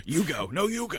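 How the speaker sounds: lightly voiced standoff, pitch jumping up on the reply; imitated polite drivers. Host voices two cars backing into each other, playful imitation. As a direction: play both drivers, tickled by it. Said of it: "They're just, like, backing up into each other".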